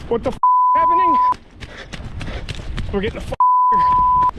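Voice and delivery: panicked scream. Real, not acted. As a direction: shriek in pure panic. Panicked fleeing.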